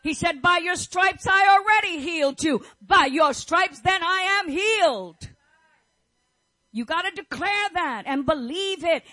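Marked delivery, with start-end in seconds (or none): 0.4-5.3 loud, higher proclamation; preacher proclaiming healing, loud; shouted declaration. Acted. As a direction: thunder the promise out. Quoting God's promise, shouting.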